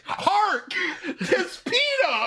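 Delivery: high, slow, grand stage proclamation; mock-heroic. Host as a customer discovering pita, mock-heroic awe. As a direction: proclaim it like a hammy old-time actor.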